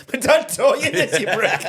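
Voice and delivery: high, loud, indignant squawk; mock pained outcry. Brother-in-arms lesson backfires in armlock, mock pained yell. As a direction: yell it like your arm is being bent back. Retold cry while arm nearly broken.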